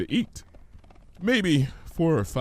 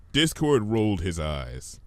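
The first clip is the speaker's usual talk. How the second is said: ordinary delivery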